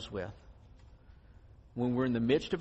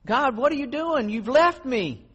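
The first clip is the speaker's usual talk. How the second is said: loud, high, slow cry of despair; desperate cry; 10 semitones higher, 6 dB louder than usual. Retold or imitated. Believer in trouble crying out loud to God. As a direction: cry out that God has left you. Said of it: "we say".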